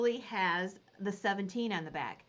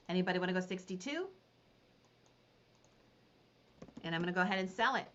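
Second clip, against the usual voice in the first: quick, rhythmic auction patter repeating the bid; auctioneer call; faster than usual. Acted. Auctioneer calling bids to the Facebook viewers, quick rhythmic patter.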